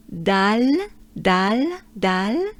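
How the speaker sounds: dal with an open French A; dal like the dish in French. Shown as a demonstration. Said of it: "but with a very French accent".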